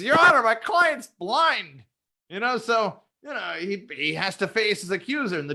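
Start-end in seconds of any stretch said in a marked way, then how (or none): 0.0-1.8 high, slow, pompous plea; hammed-up defense lawyer; mock lawyer. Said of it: "He says, he says".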